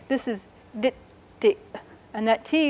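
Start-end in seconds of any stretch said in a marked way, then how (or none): 0.1-1.6 "dit" with a long T and a glottal stop at the end. Shown as a demonstration. Teacher models "dit" for learners, glottal stop on the end. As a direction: hold the T in "dit", then cut it off in the throat. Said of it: "Glottal stop on the end".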